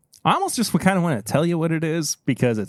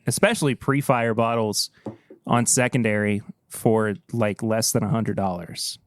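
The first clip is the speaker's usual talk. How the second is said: ordinary talk, nothing marked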